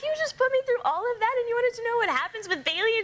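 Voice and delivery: high-pitched, incredulous retold protest; indignant disbelief. New driver retelling her reply to the examiner, high-pitched disbelief.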